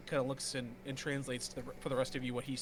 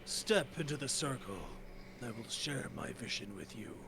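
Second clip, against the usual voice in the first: earth guardian; 4 dB quieter than usual. Acted. GM voices Torba Shul again.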